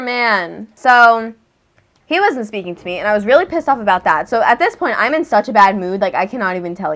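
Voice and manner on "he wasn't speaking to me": ordinary talk, nothing marked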